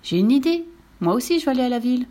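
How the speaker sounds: eager horse announcing a plan; excited exclamation. Acted. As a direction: cry out a sudden bright idea. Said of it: "il s'écria".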